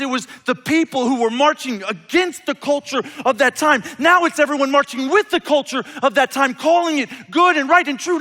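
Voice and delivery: loud, high, driving sermon voice; full-force preaching. Acted. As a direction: preach it hard to the whole room.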